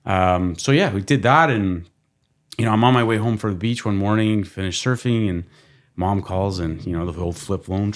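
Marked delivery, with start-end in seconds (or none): none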